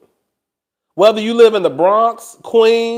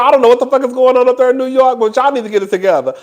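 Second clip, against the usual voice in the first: normal talking voice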